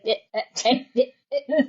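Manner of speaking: imitated stuck-computer stutter, repeated "eh"; mock glitching noises; glitchy stuck stammer. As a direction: stammer on one syllable like a frozen machine. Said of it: "Where's your reboot button".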